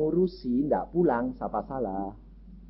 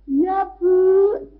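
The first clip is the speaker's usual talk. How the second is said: imitating a sugary maid voice, much higher; sweet maid again; 13 semitones higher than usual. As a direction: switch back to a falsely sweet reply. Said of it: "terus bentar lagi bilang". (menirukan pembantu yang kembali manis, jauh lebih tinggi)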